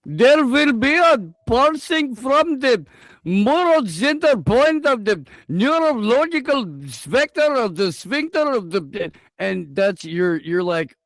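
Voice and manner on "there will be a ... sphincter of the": exaggerated Indian accent, pitched way up; high, swooping parody of an Indian-accented narrator; mock Indian accent. Host mocks the video's technical narration, exaggerated high Indian accent. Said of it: "in that particular Indian accent".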